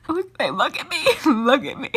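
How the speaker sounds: sobbing, half laughing at yourself; tearful, words broken by sobs; crying. Real, not acted. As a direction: overcome and helpless. Reader breaking down in tears.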